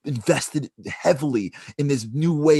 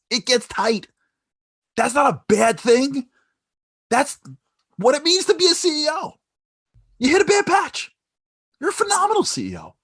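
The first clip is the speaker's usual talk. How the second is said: much higher and louder, rousing; fierce encouragement; 5 dB louder than usual. Retold or imitated. Warren Buffett rallies the ruined son, rousing shout. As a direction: shout belief into him.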